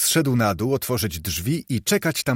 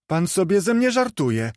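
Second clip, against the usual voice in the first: put-on lightly raised, lively boy; a street boy puzzled by the question; boy's voice; 7 semitones higher than usual. A narrator's reading. Boy in the street answering Scrooge, lightly voiced.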